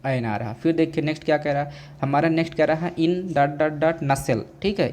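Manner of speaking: ordinary talk, nothing marked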